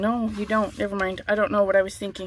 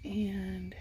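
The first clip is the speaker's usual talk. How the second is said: soft unvoiced whisper aside; 3 semitones lower, 9 dB quieter, slower than usual. Real, not acted. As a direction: whisper it off mic. Aside to someone off camera.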